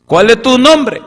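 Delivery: high, loud insistent question; Moses demanding. Acted. Moses pressing God loudly for his name. Preacher voices Moses asking.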